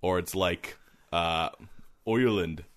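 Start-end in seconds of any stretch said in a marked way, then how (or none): 2.1-2.6 put-on Irish accent, opening on "oy"; Irish touchstone word. Shown as a demonstration. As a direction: say Ireland like an Irishman. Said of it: "I say the place the accent is from".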